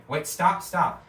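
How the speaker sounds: urgent cartoon stop shout. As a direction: yell at him to quit it. Character begs friend to stop.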